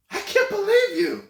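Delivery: high, loud mock outrage; indignant woman. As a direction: impersonate her shocked accusation. Woman accusing him.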